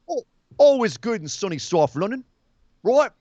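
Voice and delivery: put-on Cockney; Cockney accent. Showing co-host how South London sounds, mock Cockney. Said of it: "It's Cockney".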